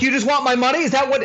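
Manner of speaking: high, indignant hysterical questioning; mock screaming. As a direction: accuse them in a hammed-up frenzy. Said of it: "I just started screaming".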